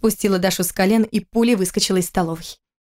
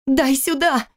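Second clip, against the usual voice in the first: higher, frightened cry; 7 semitones higher, much slower than usual. Acted. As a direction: she grabs for the pills in fright. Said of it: "закричала Кира испуганно". (испуганный крик, выше)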